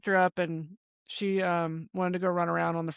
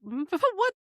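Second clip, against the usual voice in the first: laughing disbelief; 6 semitones higher, slower than usual. Retold or imitated.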